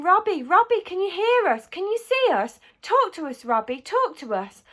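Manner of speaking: high, loud calls, easing towards the end; urgent excited pleading. A narrator's reading. Parents call to their waking son, urgent pleading.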